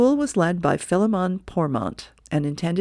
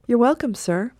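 lightly raised polite assistant voice; assistant's reply; 6 semitones higher, 3 dB louder than usual. Acted. Assistant thanking her boss, lightly voiced and polite. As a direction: answer the boss sweetly and politely.

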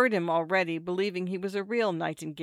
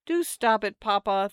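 raised, higher imploring plea; a daughter's mild plea to her father; 4 semitones higher, 6 dB louder than usual. A narrator's reading. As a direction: implore him, pitched up and louder. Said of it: "the daughter implored".

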